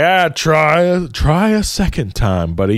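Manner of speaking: ordinary delivery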